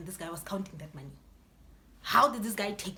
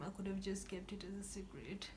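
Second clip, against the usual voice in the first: whispered regret; 3 semitones lower, 15 dB quieter than usual. Retold or imitated. She regrets telling her dad, whispered aside. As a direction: whisper it under your breath.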